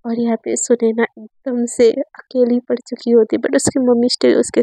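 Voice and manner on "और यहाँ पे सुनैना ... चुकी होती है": ordinary delivery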